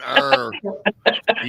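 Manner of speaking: high, loud pirate arr; pirate growl. Acted. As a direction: cheer it like a pirate. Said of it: "become a B M R pirate".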